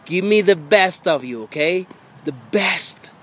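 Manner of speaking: ordinary talk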